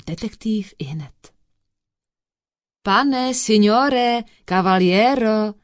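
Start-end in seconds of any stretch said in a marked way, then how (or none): 2.8-5.5 loud Italian hawker's patter; jabbering Italian rope-seller. A narrator's reading. Disguised detective accosts the foreigner, jabbering. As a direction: accost a customer loudly as an Italian pedlar. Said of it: "začal na něj švadronit lámanou češtinou".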